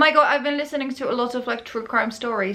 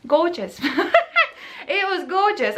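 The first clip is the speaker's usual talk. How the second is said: higher, laughing sarcastic gorgeous; laughing ironically; 7 semitones higher than usual. Real, not acted. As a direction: laugh off the horror with irony. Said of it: "ironic laughing remark".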